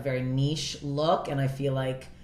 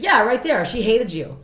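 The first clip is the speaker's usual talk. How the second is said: raised, louder retold accusation; imitated blunt guy; 6 semitones higher, 8 dB louder than usual. Comic retelling a guy's report, imitated bluntness. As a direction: point her out loudly, as a quote. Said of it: "And he's like".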